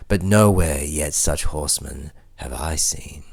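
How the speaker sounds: usual speaking voice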